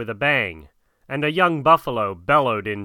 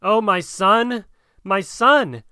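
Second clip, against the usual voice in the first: high-pitched, drawn-out motherly cry; slow, raised cry of distress; 7 semitones higher, slower than usual. A narrator's reading. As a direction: call to your son with a pleading lift. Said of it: "and cried".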